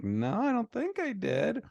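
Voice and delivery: high, puzzled wife voice; imitating wife. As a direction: play her innocently unsure. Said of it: "And she's like".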